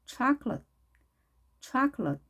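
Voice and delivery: "초콜릿" as CHAH-klut, stress up front; English pronunciation demo. Shown as a demonstration. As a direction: model "초콜릿" with open ah and light L. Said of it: "혀끝을 붙여주고 발음해야".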